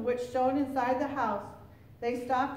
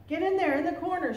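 quick, higher-pitched command; urgent order; 5 semitones higher, 4 dB louder, faster than usual. A narrator's reading. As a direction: hustle the child into hiding. Old woman orders boy to hide.

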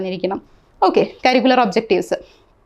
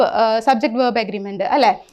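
normal talking voice

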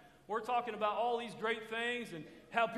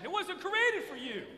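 higher, louder, slower and fervent; with passion; 9 semitones higher, 6 dB louder than usual. Shown as a demonstration. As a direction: throw real passion into it. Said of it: "Now say it with some passion".

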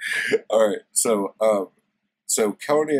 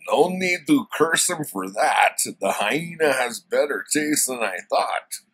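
loud, higher, hammed-up barbarian hero; badly faked Conan, louder and higher; horrible Conan voice; 7 semitones higher, 7 dB louder than usual. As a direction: overdo the brute with a big proud delivery. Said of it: "this is a horrible Conan voice".

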